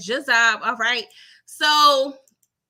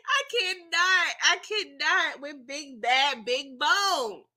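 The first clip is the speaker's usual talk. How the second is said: slow, drawn-out I cannot; exasperated; 4 semitones higher, slower than usual. Real, not acted. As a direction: stretch it out, done with it.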